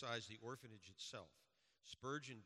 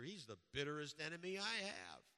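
a hint of an old preacher, raised; old preacher exclaiming; 5 semitones higher, 6 dB louder than usual. Preacher correcting Washington, mildly raised and insistent. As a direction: insist it loudly, half in character. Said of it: "exclaimed the old preacher".

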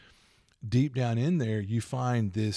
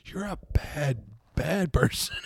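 playful scolding; 5 semitones higher, slower than usual. Retold or imitated. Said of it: "And I'm like".

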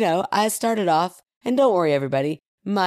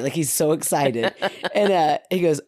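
ordinary delivery

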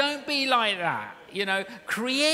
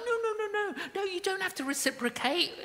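high, far-off mock protest; squeaky protest; 10 semitones higher, faster than usual. Pastor imitating his couple refusing the restaurant owner, high mock protest. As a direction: squeal a polite no-no-no. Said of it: "And we're like".

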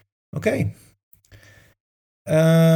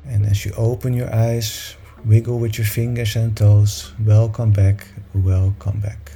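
guided meditation; 2 semitones higher than usual. Acted. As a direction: gently lead them back to the room. Leads a meditation over music.

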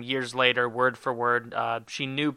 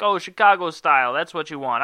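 put-on brash New York accent, pushed up; 2 semitones higher, 5 dB louder than usual. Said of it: "I'm talking in a New York accent".

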